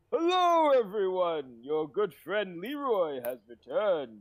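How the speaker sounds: grand, high-pitched hello easing down; theatrical hail to the whole party; Leroy. Acted. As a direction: make a loud, showy entrance. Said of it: "and I'm like".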